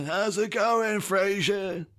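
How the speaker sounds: high, badly faked English accent; Simon. Brother greeting Frasier, imitated awful English accent. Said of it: "he is the worst English accent".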